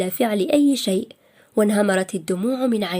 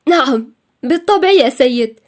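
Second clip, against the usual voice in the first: louder, lightly tearful reply; a touch of tears in a raised voice; 3 semitones higher, 7 dB louder than usual. A narrator's reading. Employee in tears answers her scolding boss, tearful. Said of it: "الدموع أخذت تنهمر بدون إرادتها".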